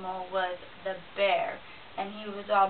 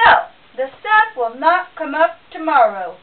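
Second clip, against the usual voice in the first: booming bear; 4 semitones higher, 12 dB louder than usual. A narrator's reading. Bear bragging to himself, booming loud. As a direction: puff up and boom like a proud bear.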